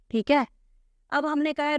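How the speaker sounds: normal talking voice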